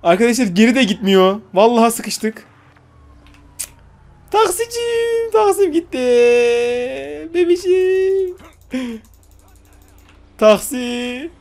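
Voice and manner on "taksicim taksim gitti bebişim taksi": very high, slow mock wail for the lost taxi; hammed-up high whine over the taxi; mock lament. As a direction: wail like a heartbroken lover.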